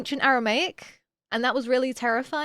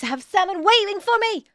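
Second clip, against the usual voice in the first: high, loud burst of delight; excited realization; 7 semitones higher, 5 dB louder than usual. Real, not acted. Streamer remembers dinner is waiting, excited. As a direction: exclaim it with sudden glee.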